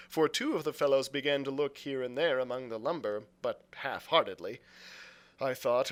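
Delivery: ordinary delivery, nothing marked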